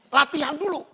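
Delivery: usual speaking voice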